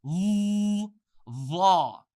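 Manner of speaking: "L-, law." buzzed like a blender, then loud; blender buzz into lam. Shown as a demonstration. Blender vibration demo for students, "L-, law." buzzed loud. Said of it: "the blender said".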